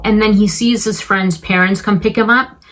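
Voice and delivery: ordinary talk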